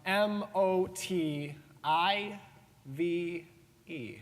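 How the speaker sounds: very slow, letter by letter; spelling it out. Acted. Speaker puzzles over being asked his motive, spelling it slowly. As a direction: draw out each letter with gaps between.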